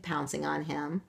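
ordinary delivery, nothing marked

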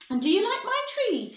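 high, loud showman's question; eager high-pitched call; excited cry. A narrator's reading. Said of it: "he cried".

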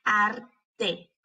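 "Arte" in two slow, separated syllables; split into syllables. Shown as a demonstration. Teacher showing the closed syllable, syllable by syllable. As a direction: break "Arte" as Ar, pause, te. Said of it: "para ver cuáles de esas sílabas".

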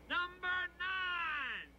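high, long drawn-out holler; high-pitched stretched-out call; yelled out. Retold or imitated. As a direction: holler it slowly down a cellblock. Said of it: "he yells out".

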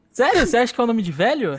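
offended surprise. Real, not acted. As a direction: take mock offence at the joke. Offended at the joke.

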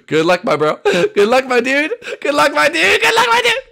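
repeated cry climbing from loud to squealing; mounting taunting cheer. Pretend. Gamer wishing Corbin good luck, escalating into frantic squeals.

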